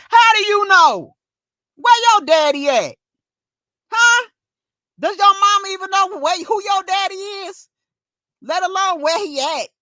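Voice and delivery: high, loud taunting yell. Real, not acted. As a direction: shout down the haters, mocking. Shouting down hostile commenters.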